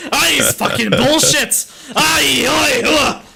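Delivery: hammed-up Scottish accent, high and loud; slow, shouted parody of Scottish outrage; mock angry Scotsman. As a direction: imitate a ranting political Scotsman. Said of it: "I can feel your political Scotsman coming out".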